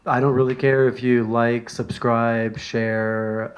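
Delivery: ordinary talk, nothing marked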